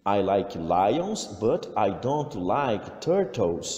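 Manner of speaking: slow, clear dictation for learners; a teacher reading a listening text aloud, slow and clear; slow English listening read. Shown as a demonstration. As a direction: read it out slowly, word by word. Reads the Anna audio text.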